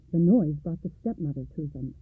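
usual speaking voice

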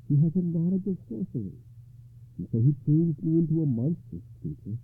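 lady's voice. Acted. Ragnall tells her story.

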